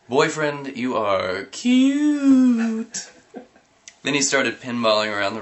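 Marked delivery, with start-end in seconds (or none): 0.1-3.1 very high, slow drawn-out flirt. Acted. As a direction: coo it, stretching it out.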